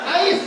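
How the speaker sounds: "Ais" high, loud, ending on a clear S; exasperated correction. Retold or imitated. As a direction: shout "Ais" to fix the pronunciation. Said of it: "Gua bilang". ("Ais" keras dan tinggi, menegaskan S)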